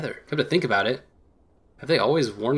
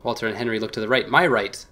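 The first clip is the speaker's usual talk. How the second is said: usual speaking voice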